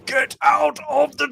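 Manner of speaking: high, loud mock roar; hammed-up yell to clear the room; imitated angry shout. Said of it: "he just apparently shouted".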